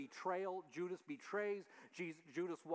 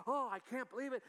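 excited; 7 semitones higher, 3 dB louder than usual. Retold or imitated. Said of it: "it was just amazing".

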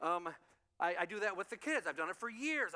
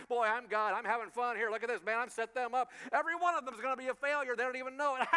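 louder, taunting, teasing voice tossing out a dare; mock gloating God; 5 semitones higher, 7 dB louder, faster than usual. Preacher's mock God taunting mankind to the angels, loud gloating tease. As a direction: tease them like a smug player dangling a prize. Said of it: "Looking at the angels, going".

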